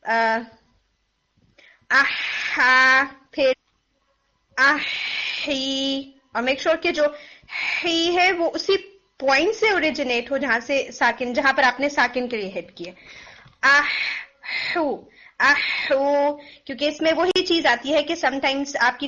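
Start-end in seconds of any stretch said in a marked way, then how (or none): 1.9-6.1 आहा and आही drawn out very slowly, each vowel held; practice drill. Shown as a demonstration. Tajweed teacher drilling the letter with each vowel. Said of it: "तीनों के साथ आप इसको practice करें". 13.7-16.4 आहा and आही drawn out very slowly, each vowel held; practice drill. Shown as a demonstration. Tajweed teacher drilling the letter with each vowel. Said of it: "practice करें".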